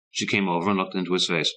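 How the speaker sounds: usual speaking voice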